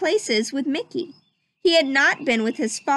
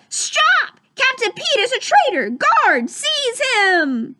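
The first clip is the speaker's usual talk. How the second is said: very high, loud mouse shout; Mickey Mouse shout; 13 semitones higher, 5 dB louder, slower than usual. Acted. Mickey denounces Pete at the coronation, high shout. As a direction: cry out the accusation.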